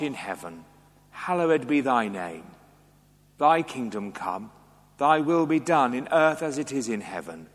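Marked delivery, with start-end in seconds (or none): none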